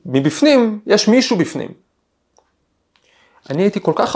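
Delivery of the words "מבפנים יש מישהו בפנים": high called-out warning through a door; mock shout from inside a train toilet; retold shout. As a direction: call out that it's occupied. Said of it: "ומישהו צעק".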